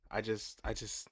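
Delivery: ordinary talk, nothing marked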